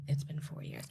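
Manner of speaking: barely voiced whisper; very soft, whispered aside; hushed disbelief. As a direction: whisper it as if just realising. Astonished aside to herself.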